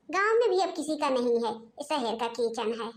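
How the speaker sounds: ordinary delivery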